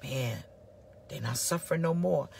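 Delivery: low, soft funeral sigh; a mourner resigned at a funeral; mournful sigh. Retold or imitated. Funeral guest sighing about the dead. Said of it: "be like".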